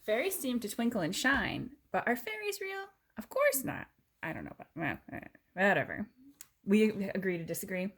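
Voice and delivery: lively storybook read-aloud; reading to a child. Acted. Parent reading a fairy book to daughter, storybook voice. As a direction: read it bright for the little one.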